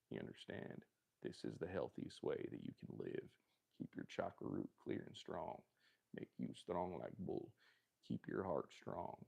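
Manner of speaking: low, soft wellness-guru voice; low solemn murmur; hushed guru. Pretend. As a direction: advise them quietly like a guru. Hushed guru advice on honey.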